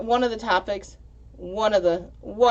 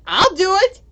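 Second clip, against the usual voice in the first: much higher and louder, begging to go first; eager kid volunteering; 9 dB louder than usual. Retold or imitated. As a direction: jump up and down shouting pick me. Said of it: "He's like".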